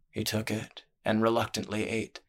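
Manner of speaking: plain reading voice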